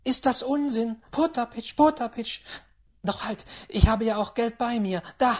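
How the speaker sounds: put-on high-pitched, brisk old lady; impatient great-aunt. Great-aunt calls servant, offers money.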